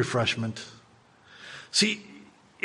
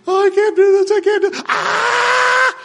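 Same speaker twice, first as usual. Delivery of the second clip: high, loud panicked cries; scared rider; 8 dB louder than usual. Acted. Preacher acting a terrified coaster rider, high panicked squeal. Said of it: "the first person is the one who is scared".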